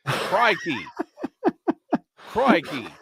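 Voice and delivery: hammed-up Aussie exclamation; amateur Australian impersonation, testing the accent; bad Australian accent. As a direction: try out a shaky-grasp Australian accent. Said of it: "trying to go for an Australian accent".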